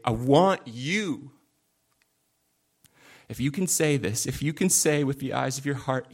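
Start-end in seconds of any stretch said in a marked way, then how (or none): 0.0-1.3 slow, hushed heartfelt plea; tender longing. Acted. Believer speaking to God, tender and slow. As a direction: say it like a whispered confession of love.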